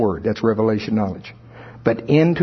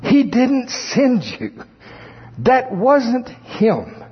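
much higher, very slow, gentle sweetness; sweetly and nicely; 10 semitones higher, much slower than usual. Retold or imitated. Pastor gently corrects a confused church member, very slow and sweet. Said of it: "sweetly and nicely".

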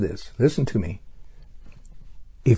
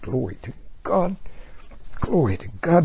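ecstatic praise; 4 semitones higher, 5 dB quieter than usual. Real, not acted. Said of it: "About to have a running fit".

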